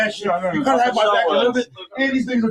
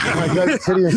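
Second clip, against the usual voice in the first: ordinary delivery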